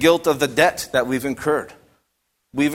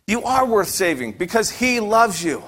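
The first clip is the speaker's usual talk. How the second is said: ordinary talk, nothing marked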